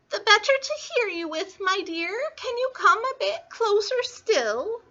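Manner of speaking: high, put-on old-lady falsetto; fake grandmother. Wolf asking Little Red to come closer, high fake granny voice. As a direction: coax sweetly as a phony granny.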